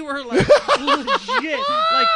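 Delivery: laughing loudly, words breaking up. Real, not acted. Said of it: "laughing through it".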